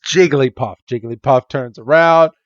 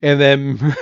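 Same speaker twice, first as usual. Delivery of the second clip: ordinary talk, nothing marked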